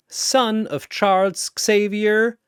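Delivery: ordinary delivery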